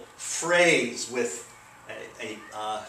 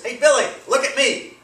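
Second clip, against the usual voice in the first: much higher, loud call to Billy; excited call; 11 semitones higher, 6 dB louder than usual. Acted. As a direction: call out to get Billy's attention. Example sentence acted out.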